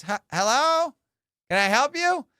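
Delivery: lightly put-on voice of a polite lady; lady on intercom. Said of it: "some lady, she gets on, she goes".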